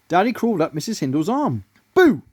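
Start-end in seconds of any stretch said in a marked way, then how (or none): 1.9-2.2 high loud mock-scary boo; menacing boo. A narrator's reading. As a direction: jump out to scare her. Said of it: "he smirked menacingly".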